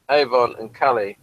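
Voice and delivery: ordinary talk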